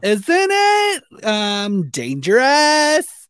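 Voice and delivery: high, loud, drawn-out uptalk; Valley Girl voice. Retold or imitated. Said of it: "She's a hundred percent a Valley Girl".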